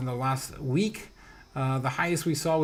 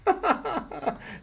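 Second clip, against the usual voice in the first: high laugh; 11 semitones higher, 5 dB quieter than usual. Real, not acted. Candle finally lit.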